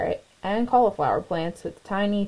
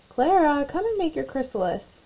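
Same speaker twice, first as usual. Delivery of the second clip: calling out; 6 semitones higher than usual. A narrator's reading. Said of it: "Cornelius called".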